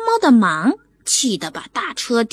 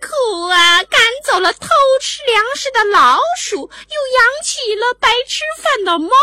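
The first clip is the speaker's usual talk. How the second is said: high, louder sarcastic whine with fake crying; mocking the owner; 11 semitones higher, 5 dB louder than usual. Cat mimicking the owner's sneering, sarcastic complaint. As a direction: whine in a snide put-on voice. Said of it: "模仿着主人那阴阳怪气的腔调".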